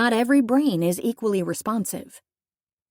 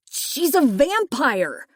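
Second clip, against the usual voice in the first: higher, slow, frightened cry; scared accusing yell; panicked outburst; 6 semitones higher, 3 dB louder, slower than usual. Acted. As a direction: blurt it in terror. Captive panics at vampire.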